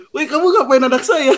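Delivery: high-pitched mock yell of an alarmed mother. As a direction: act out a mum calling out a stranger. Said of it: "Mamanya teriak".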